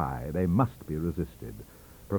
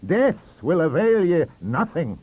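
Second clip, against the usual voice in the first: slow, loud Scots dismissal; triumphant; 9 semitones higher, 8 dB louder, slower than usual. Acted. Librarian gloating over Le Pan, slow and loud. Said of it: "he triumphed".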